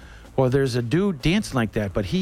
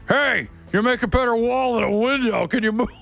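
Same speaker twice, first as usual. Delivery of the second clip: mimicking an irritated man, pitched up; hammed-up grouchy complaint; grumpy old man; 8 semitones higher than usual. A cranky guy with a cane tells the narrator to move, mock grumpy.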